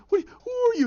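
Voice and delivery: very high, loud yelp; startled panic. Retold or imitated. Captive to guards bursting in, panicked. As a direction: blurt it out high in alarm.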